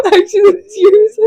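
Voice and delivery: high, tearful laughing protest; laughing to tears. Real, not acted. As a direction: insist through real tearful laughter.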